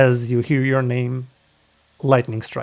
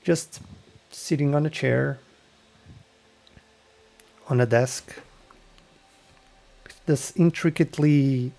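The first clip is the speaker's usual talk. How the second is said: game master narration. Acted. Game master setting the scene for the player.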